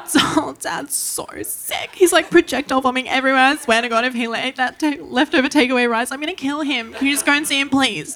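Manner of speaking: high, tearful whiny sister voice; mock sobbing sister. Sister on the phone bawling about Dad, mock sob. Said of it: "she's bawling her eyes out".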